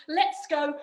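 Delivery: ordinary delivery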